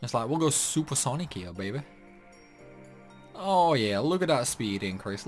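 normal talking voice

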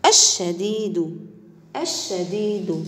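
الشديد said slowly with crisp د sounds; slow model reading. Shown as a demonstration. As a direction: drag out الشديد for pupils to copy. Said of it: "سوف نقوم بقراءتها مرة أخرى". (نطق بطيء للشديد بدال واضحة)

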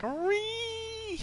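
very high squeal of glee; gleeful squeal. Pretend. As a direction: squeal it like a happy kid. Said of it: "We're gonna be like".

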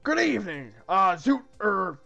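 repressed emotion. Acted. Galahad meets a stunning young lady, flustered. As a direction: greet her awkwardly, too loudly, holding feeling down. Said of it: "gulps with repressed emotion".

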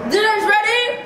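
put-on calling kids to dinner. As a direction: call through a laugh.

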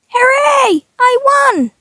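high cheer of triumph; victory cheer. Acted. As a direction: cheer like you just won the round. Character celebrates a win.

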